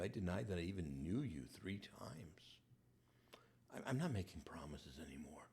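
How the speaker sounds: ashamed whisper. Acted. Peter confessing to the Lord, whispered in shame.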